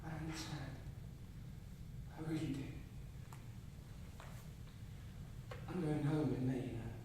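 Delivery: very soft, low, consoling tone; gentle reassurance. Acted. Inviting a friend to stay in Ireland, gently hushed. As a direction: offer comfort softly and low.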